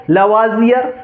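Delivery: लवाजियर as la-vaa-zi-yar, rising at the end; listed variant लवाजियर, la-vaa with a z; alternative pronunciation. Shown as a demonstration. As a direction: say लवाजियर with open a-vowels and z. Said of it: "कई लोग इसे लवाजियर भी पढ़ेंगे".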